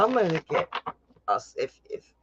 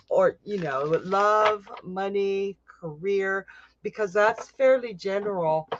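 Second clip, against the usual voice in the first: usual speaking voice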